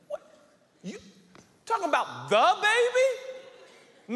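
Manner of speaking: high, drawn-out incredulous question; shocked disbelief. Acted. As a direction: stretch it out in rising shock. Joe shocked by Mary's news.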